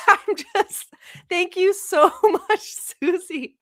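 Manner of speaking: high, giggling thanks; real laughter breaking up the words; laughing through it. Real, not acted. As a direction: thank them while still laughing at the mix-up. Laughing at her own mistake.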